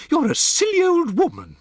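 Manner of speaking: very high, loud sneering insult; contemptuous. Acted. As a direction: scorn her to her face. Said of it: "Missus Jenkins said".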